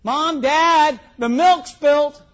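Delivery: put-on high-pitched child calling to parents, drawn out; mock kid's tattling cry; kids yelling out. Kids report spilt milk to Mom and Dad, yelling out. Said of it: "they would yell out".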